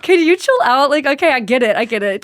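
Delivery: mock exasperation at a teasing sister. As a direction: tell her to chill, half-laughing.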